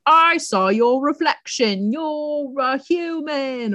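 slow, high, theatrical accuser; mock accusation. Jadrian accusing Pearl after the blood ceremony, hammed-up.

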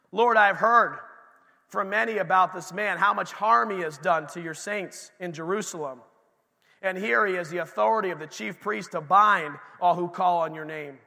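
reading Ananias's words aloud with some force; a preacher reading scripture to the congregation, firm and clear. Acted. Preacher reading Ananias's plea to the Lord to the congregation, emphatic.

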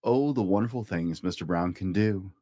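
usual speaking voice

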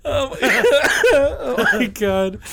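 high words broken by laughter; real cracking up, pitched high; helpless laughing. Real, not acted. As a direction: gasp it out mid laughing fit. Cracking up over the bark.

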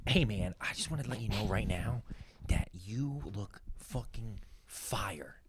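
very slow, whispered compliment; hushed. Retold or imitated. Comedian quoting a friend's praise before the set, hushed and slow. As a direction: draw it out in a near whisper. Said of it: "I thought that was gonna be louder".

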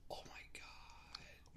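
gasped, breathy whisper of shock; mock gasp, then a slow, hushed whisper; retold shocked reaction. Retelling the audience's reaction to the death, shocked whisper. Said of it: "you got that".

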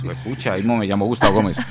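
normal talking voice

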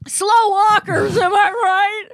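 high, slow crying shout; a hammed-up wailing complaint about slow walkers; mock wail. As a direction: wail it like a comedian's outrage. Comic rant about pet peeve.

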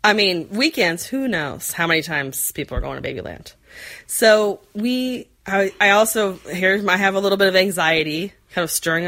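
ordinary talk

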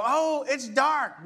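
a touch of hammed-up despair; mock gloom. Pastor mimics people seeing darkness, mock dismay. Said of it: "look out and go".

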